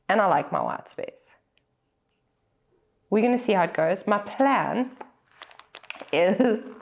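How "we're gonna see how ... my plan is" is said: normal talking voice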